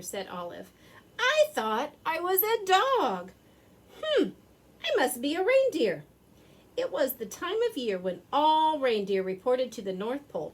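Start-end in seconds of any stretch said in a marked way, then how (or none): 1.2-6.0 puzzled dog voice. A narrator's reading. Dog character realizing aloud.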